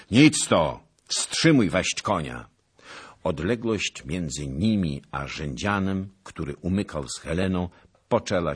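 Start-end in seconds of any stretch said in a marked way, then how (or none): 0.1-2.5 loud, steady order; firm command. A narrator's reading. Knight to alarmed nobleman, loud firm command. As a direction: cut through his panic with a firm call.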